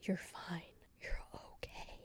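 very soft whispered reassurance; hushed mock comfort, barely voiced; imitated soothing. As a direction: whisper it as if calming a friend. Friends soothing her, acted out.

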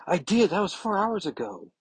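high-pitched defensive protest; indignant comeback. Retold or imitated. Kitty answers about going before they left, high indignant protest. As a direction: protest in a high, put-upon voice.

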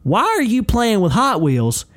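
high, loud scolding; coach laying into kid. Retold or imitated. Coach scolds a student for toys in class, laying into him. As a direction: build to a loud, indignant demand. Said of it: "He starts laying into this kid".

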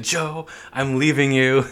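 slow, theatrical farewell line; dramatic exit. Retold or imitated. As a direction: play it like a soap-opera exit. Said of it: "she's doing this dramatic exit".

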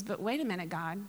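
soft, low, hesitant interjection; acted-out meek appeal; tentative plea. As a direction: gently break in, almost under the breath. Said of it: "So Moses is talking to God".

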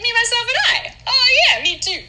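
high giddy lady voice; high squealing lady. Acted.

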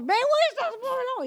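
high, loud exasperated holler; repeated parental call. Retold or imitated. Mother calls unresponsive kids again, loud rough holler. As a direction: holler at kids who never answer. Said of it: "je recommence".

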